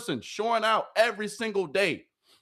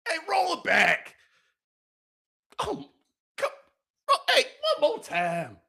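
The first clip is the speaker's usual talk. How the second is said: higher, hyped shout; excited cry; 7 semitones higher than usual. Real, not acted. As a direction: shout for the replay. Hyped, asks to replay.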